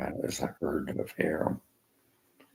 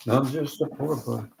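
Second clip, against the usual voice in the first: mocking quote; 8 semitones higher than usual. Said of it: "He says".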